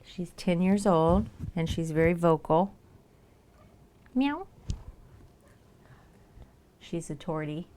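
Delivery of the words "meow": high rising mock meow; playful cat sound; imitated meow.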